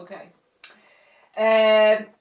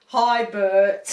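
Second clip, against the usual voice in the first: laughing greeting; 9 dB louder than usual. Pretend. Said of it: "what did the other shark say".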